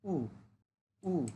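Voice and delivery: উ short and falling; Mandarin fourth tone. Shown as a demonstration. Teacher shows the fourth tone on উ, short fall. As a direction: snap উ down. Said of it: "fourth tone short করে বলতে হবে".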